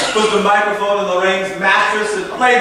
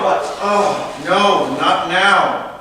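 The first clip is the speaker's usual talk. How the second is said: ordinary delivery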